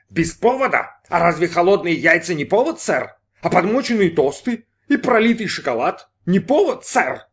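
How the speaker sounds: high, outraged rhetorical questions; indignant. Acted. Brin complains of cold eggs to lieutenant, high indignation.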